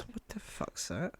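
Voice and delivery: a bewildered reader grumbling to himself; hushed disbelief at the headline; mock baffled reader. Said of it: "and just says".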